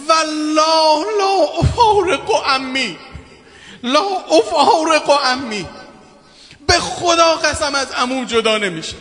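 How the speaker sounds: drawn-out lamenting shout; child's loud cry. Retold or imitated. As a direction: cry out the child's refusal to leave. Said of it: "با صدای بلند فریاد زد".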